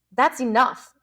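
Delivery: short sharp cut-off. A narrator's reading. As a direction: shut him down, sharp and firm. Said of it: "said Ralph sharply".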